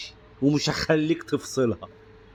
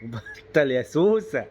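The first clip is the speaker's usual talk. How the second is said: real laughter; 3 semitones higher, much slower than usual. Real, not acted. Laughing at baby.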